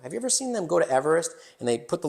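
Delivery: ordinary talk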